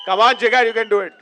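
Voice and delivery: loud, cheering call. Real, not acted. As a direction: hype up the singer across the room. Cheering the singer on.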